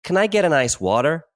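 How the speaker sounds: high mock American accent; American-style order with a flapped "water"; put-on American accent. So I've had to say it, put-on American accent. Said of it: "put the accent on".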